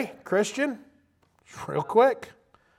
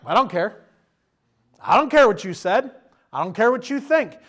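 defiant shout; 4 semitones higher, 5 dB louder than usual. Acted. Three men defy the king.

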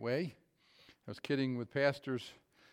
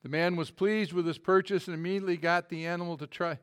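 normal talking voice

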